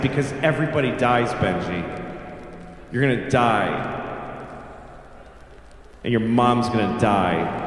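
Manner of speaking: put-on menacing kid, pitched low with long pauses; cold deadpan. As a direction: deliver the bad news with cruel calm. Child coldly delivers bad news.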